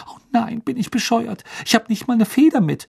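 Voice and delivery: put-on high-pitched girlish fluster; dismayed girl in a high voice; flustered schoolgirl. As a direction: wail it high like a frantic fan. Girls frantically searching for a quill.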